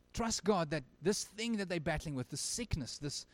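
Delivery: normal talking voice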